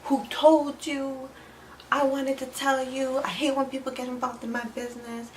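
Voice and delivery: high-pitched mimicry of a flustered man; mock upset. Acts out his upset at being exposed.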